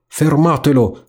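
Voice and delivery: a hint of an angry rat crying out; yelling rat. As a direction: rat starting to call after the boat. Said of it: "come gridava".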